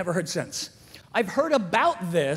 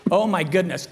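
normal talking voice

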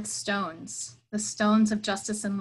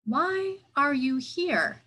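slow, higher, calm question; 5 semitones higher, slower than usual. A narrator's reading. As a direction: ask slowly, unhurried and patient. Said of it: "the calm voice of the wise man".

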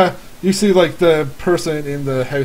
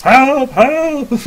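high, loud, drawn-out call; yelled cry for help; 10 semitones higher, 7 dB louder than usual. Acted. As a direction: yell for help from another room. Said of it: "like, someone yelling".

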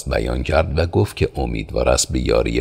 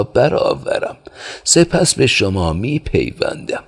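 put-on a kindly old innkeeper, pitched higher; old father's voice; 7 semitones higher than usual. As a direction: hospitable old man ushering guests indoors. Old father invites them inside. (صدای پیرمرد مهربان و میزبان، زیرتر)

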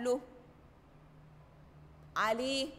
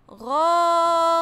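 مشغول with the oo held long and louder; drawn-out long vowel; 3 semitones higher, 8 dB louder, much slower than usual. Shown as a demonstration. Teacher stretching the vowel for the class, slow and loud. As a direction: draw out the oo in مشغول. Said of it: "هكون كلمة إيه؟".

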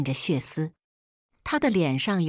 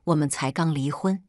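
low growl; 2 semitones higher than usual. A narrator's reading. Ex-husband confronting her right after the divorce, mild growl. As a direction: read it as a restrained snarl that breaks upward. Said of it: "他低吼道".

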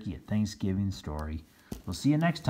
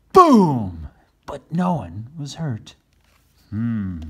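plain reading voice

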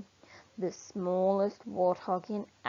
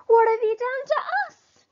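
much higher, loud animal-crowd demand; demanding other animals; 8 dB louder than usual. Acted. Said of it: "demand the other animals".

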